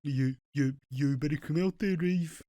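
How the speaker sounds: mock Brummie, drifting towards Australian; wobbly Brummie impression with halting starts; exaggerated Brummie accent. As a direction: imitate a hammed-up Brummie accent. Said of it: "went about ten times more brummy".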